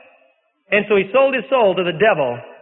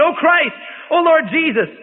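plaintive plea; 8 semitones higher than usual. A narrator's reading. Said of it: "in plaintive accents, he would plead".

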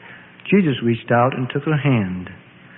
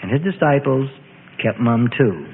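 ordinary delivery

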